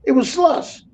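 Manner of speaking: exasperated repeat. Shown as a demonstration. Trucker mocking California chain laws, incredulous. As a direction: repeat it like you can't believe it. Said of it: "repeats slush claim for emphasis".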